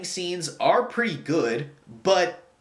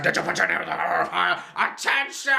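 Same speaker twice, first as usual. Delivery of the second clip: mock manic wizard, higher, jumping up to a yell on "Attention"; cuckoo Dumbledore; 3 semitones higher than usual. Reviewer mocks the film's manic Dumbledore, hammed-up. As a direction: ham up a crazed headmaster. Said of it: "the cuckoo Dumbledore that we have all memed".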